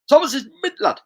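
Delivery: high, loud excited cry; excited shout. Pretend. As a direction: shout it out like a hyped fan. Excited reading of chat.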